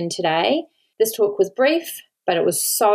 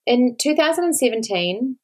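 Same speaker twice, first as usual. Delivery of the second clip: ordinary talk, nothing marked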